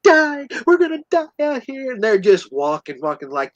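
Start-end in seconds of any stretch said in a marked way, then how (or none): 0.0-1.9 high-pitched dramatic whine of doom; acted-out panic, high and building louder; mock complaining. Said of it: "just complaining".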